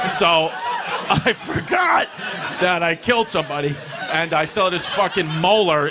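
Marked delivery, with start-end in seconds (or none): 1.1-2.1 much higher, shouted punchline; mock shout. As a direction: yell it out in mock panic.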